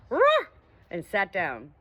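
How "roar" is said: high, loud barking roar; great bark. Acted. Dog finding the hole, barking loud and high. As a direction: let out a big dog's bark. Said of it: "let out a great bark".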